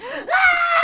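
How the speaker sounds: piercing high shriek with a laugh; terrified scream. Retold or imitated. Woman fleeing the homeless woman, screaming. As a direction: scream while running away. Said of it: "I was like".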